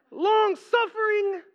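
high, slow incredulous repeat with a laugh; mock disbelief. Listener scoffing at the sermon point, slow high disbelief. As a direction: drag it out, unbelieving.

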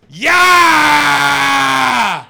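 long, high, roaring scream; angry Geordie. Retold or imitated. Comedian imitates an angry Geordie on stage, long high-pitched roar. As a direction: scream it and hold it, mimicking an enraged drunk. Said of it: "I do an impression of the angry Geordie".

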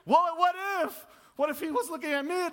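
mock panic in the car; anxious what if. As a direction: blurt it out as sudden fear takes hold. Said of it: "this fear of losing control".

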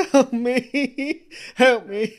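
high, loud frightened begging; hammed-up high wail for help; desperate plea. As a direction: beg for rescue in acted panic. Bombed captive begs for help.

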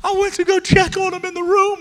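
very high, loud wail imitating a crying wife; mock sobbing. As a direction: wail it, hammed-up and squealing. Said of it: "She's just crying".

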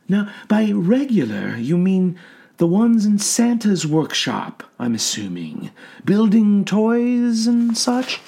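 soothing clinical questioning; calm doctor voice. A narrator's reading. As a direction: gently probe a patient. Doctor voice in read story.

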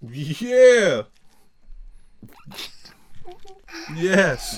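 loud, high, emphatic yes; very passionate. Real, not acted. Teacher asked about college homework, passionate outburst. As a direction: burst out with heartfelt conviction. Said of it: "You said that very passionately".

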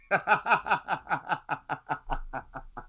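long, high run of laughter; drawn-out amused laugh; real laughing fit. Real, not acted. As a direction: crack up, soft and high, and keep going. Cracks up at being reminded.

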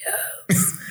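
strained, voiceless squeeze of a word; mock contraction gasp; mid-contraction. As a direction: force it out with no voice, imitating pain. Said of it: "Are you having a contraction right now?".

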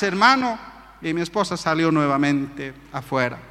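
ordinary delivery

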